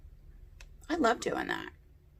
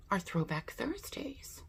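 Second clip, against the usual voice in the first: soft secretive whisper; 5 semitones higher, 10 dB quieter than usual. Acted. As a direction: lean in and whisper it. Conspiratorial aside to viewers.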